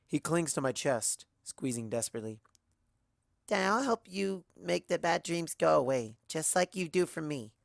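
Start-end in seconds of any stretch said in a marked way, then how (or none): 3.5-7.5 put-on high, small child's voice, worried; a young colt calling to his mothers; little colt. A narrator's reading.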